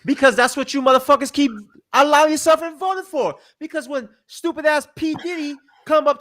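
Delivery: heated rant at the audience, loud and high. Real, not acted. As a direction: yell it at the voters, fed up. Rant building.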